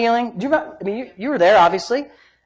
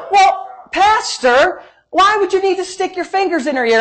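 mock questioner; 9 semitones higher than usual. Doubter questioning the pastor's method, mock puzzled. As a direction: mimic a puzzled churchgoer, pitched high.

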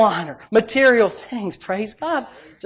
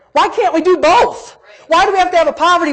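fervent challenge; 10 semitones higher, 6 dB louder than usual. Real, not acted. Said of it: "preaching passion".

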